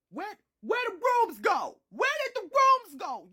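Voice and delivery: high, loud demanding where the rooms went; outraged disbelief. Retold or imitated. Child shocked by the changed mansion, loud outraged yell. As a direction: yell at the impossible house.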